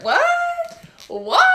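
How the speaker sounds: a shrill squeak of disbelief; shocked what. Retold or imitated. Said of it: "I was like".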